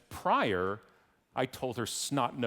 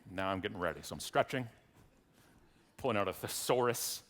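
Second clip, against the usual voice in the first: usual speaking voice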